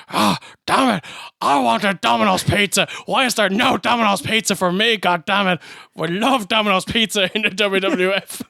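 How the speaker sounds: hammed-up aggrieved announcer on the mic; Vince. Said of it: "Then Vince comes over on the mic".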